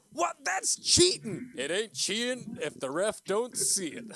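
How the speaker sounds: high, loud wrestling announcer outrage; hyped commentator calling foul; excited announcer. Acted. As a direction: shout it like a ringside commentator. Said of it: "the announcers on the side going".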